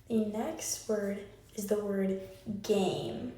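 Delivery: normal talking voice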